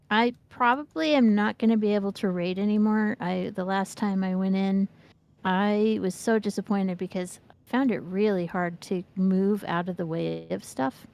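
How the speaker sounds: normal talking voice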